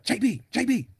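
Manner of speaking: mock high-pitched holler of a name; high, mocking name-call; belligerent calling. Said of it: "calling my name in a loud, belligerent manner".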